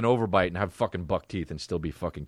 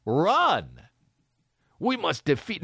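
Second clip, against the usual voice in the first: heroic anime character; 8 semitones higher than usual. Retold or imitated. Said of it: "And it's like".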